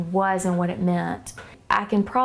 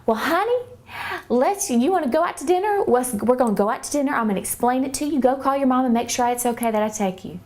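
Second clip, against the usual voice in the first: put-on higher, faster, sugary-sweet older lady; sweet little voice; 5 semitones higher, faster than usual. Bible study leader soothing a confused girl, sweet and high. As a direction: coax her like a kind church lady. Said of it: "with her sweet little voice".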